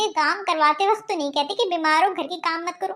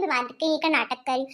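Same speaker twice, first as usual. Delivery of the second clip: mocking refrain; 7 semitones lower, 4 dB quieter than usual. [name] mocks her husband's suspicion, low sarcastic drop. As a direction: drop much lower and softer, sarcastic.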